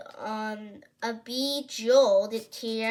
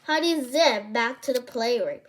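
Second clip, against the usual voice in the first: ordinary delivery, nothing marked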